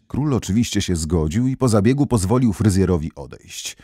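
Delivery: ordinary delivery, nothing marked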